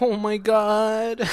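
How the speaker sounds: high, loud, drawn-out "oh my God"; mock shock. Date learning he was the killer, mock shock. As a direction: stretch it high in exaggerated horror. Voicing her shock at near death.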